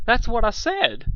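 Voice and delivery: high, loud, slow flamboyant voice; fabulous sea serpent. Acted. The vain sea serpent wailing over his lost moustache, high and theatrical. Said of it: "said Stephen".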